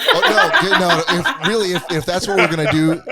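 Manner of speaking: loud, high, breaking up with laughter; laughing. Real, not acted. Host joking about what to teach him, laughing loudly. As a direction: push the words out through laughs.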